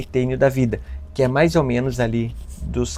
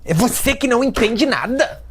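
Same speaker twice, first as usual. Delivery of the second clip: much higher, louder indignant outburst; angry teen; 12 semitones higher, 4 dB louder than usual. Retold or imitated. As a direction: snap back like an offended teenager. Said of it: "Aí já fica brava".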